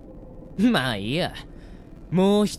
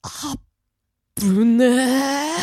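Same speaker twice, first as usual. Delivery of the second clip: relieved gasp; 10 semitones higher, 6 dB louder, slower than usual. Acted. Narrowly survived the question.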